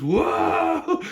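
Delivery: high loud alarmed whoa; startled shout. Retold or imitated. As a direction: yell in panic. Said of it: "he pulls her back and goes".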